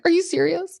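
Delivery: overwhelmed, can't believe it; stunned disbelief. Retold or imitated. Kristen to Luke on one knee, squealing disbelief. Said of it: "she's like".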